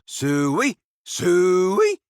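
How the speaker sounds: high, rising farmyard call; hog call. Acted. Man calling a hog, high rising call.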